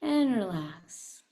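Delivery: drawn out, very slow relax; slow release. Acted. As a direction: stretch the words out, calming. Closing the chant.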